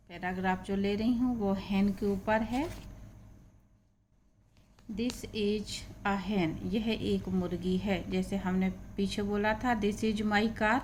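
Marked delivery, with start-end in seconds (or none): 4.9-6.5 slow, clear English reading; careful dictation pace; slow reading aloud. Shown as a demonstration. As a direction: read each word slowly for learners. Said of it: "teacher reads slowly".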